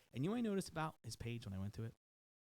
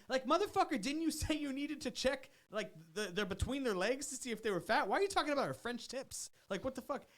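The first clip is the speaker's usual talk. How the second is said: much higher, louder incredulous rant; outraged rant; 11 semitones higher, 5 dB louder than usual. Real, not acted. Host ranting at the trainer, a loud outraged rant. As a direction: explode at him in disbelief.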